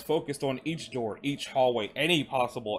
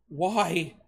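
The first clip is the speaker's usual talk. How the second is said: high, pained cry; raised, hurt voice; anguished question; 7 semitones higher, 4 dB louder than usual. A narrator's reading. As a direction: demand an answer in distress. Revived man asks in anguish.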